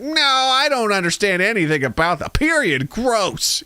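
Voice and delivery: high, mocking squeal of disgust; mock the squeamish viewer. Mocking viewers grossed out by puberty, high squeal. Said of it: "They're like".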